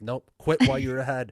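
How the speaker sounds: imitating a wife putting a stop to it; wife cutting in. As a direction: call it out firmly, as a retold warning. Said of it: "my wife comes out to the gym".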